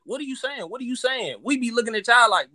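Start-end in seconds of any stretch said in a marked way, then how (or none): 0.0-1.4 lightly mimicked outsiders, pitched up; a hint of a baffled out-of-towner; mocking non-Southerners. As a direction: imitate a puzzled outsider, higher voice. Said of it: "outside of the South be like".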